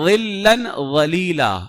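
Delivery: ظلا ولیلا slow, with a heavy emphatic ظ; tajweed recitation. Shown as a demonstration. Teacher showing the heavy letter, slow. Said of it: "یہ بھی موٹا پڑھا جاتا ہے".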